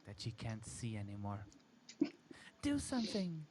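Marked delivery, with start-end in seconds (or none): 2.6-3.4 high, pleading cry for help; panicked plea. A narrator's reading. Girlfriend begging her boyfriend amid the horror, high panicked plea. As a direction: beg him in panic, voice pitched up. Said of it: "she cries".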